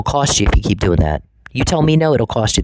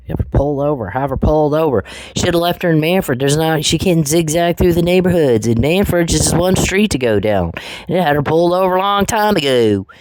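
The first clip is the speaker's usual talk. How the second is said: higher, mocking hillbilly accent; sneering hillbilly accent, pitched up; mocking hillbilly talk; 6 semitones higher than usual. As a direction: lampoon the hillbilly way of talking in a raised voice. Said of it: "You guys in your hillbilly talk".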